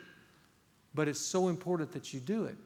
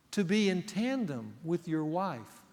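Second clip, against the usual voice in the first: ordinary delivery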